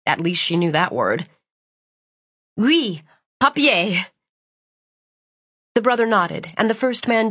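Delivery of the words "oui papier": slow French confirmation; French accent. Acted. Brother confirms slowly.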